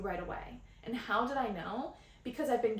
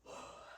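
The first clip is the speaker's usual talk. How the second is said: a long, soft, open yawn let out mid-sentence; real yawn; 15 dB quieter than usual. Real, not acted. Host yawning on the podcast for the listeners' nervous systems, a real soft yawn. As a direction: let the yawn release slowly and quietly. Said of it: "and here's a yawn".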